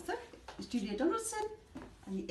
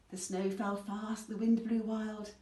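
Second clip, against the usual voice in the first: plain reading voice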